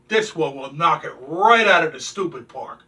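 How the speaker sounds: normal talking voice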